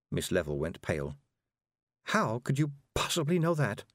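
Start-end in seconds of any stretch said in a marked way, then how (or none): 2.1-3.8 put-on startled older woman, a touch higher; mild astonished gasp of a question; shocked. A narrator's reading. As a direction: caught out and rattled. Miss Level shocked.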